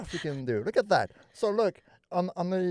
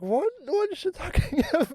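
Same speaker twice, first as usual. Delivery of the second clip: much higher, confused; baffled quote. Retold or imitated. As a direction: squeak it out in disbelief. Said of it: "I was like".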